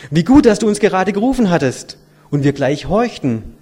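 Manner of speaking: lifted, loud storybook chatter; excited children. A narrator's reading. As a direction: exclaim it as eager children. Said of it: "sprachen sie durcheinander".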